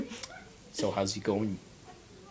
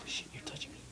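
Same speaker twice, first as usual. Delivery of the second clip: breathy hushed whisper; 20 dB quieter, faster than usual. Real, not acted. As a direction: whisper it in alarm. Startled whisper after gasp.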